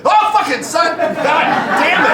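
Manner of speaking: high, loud exasperated yell; real shout of annoyance; sudden cursing outburst. Real, not acted. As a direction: curse out loud, caught off guard.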